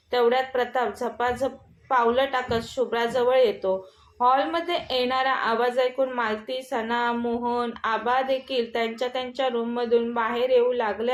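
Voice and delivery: ordinary delivery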